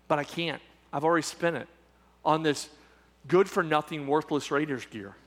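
low, soft, halting admission; mock sheepish confession. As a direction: play the contrite thief, quietly. [name] sheepishly admits spending it.

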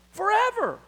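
Forever lifted high and ringing; a sudden high cry of Forever; emphatic high forever. Acted. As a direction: soar up on Forever. Preacher repeats key word.

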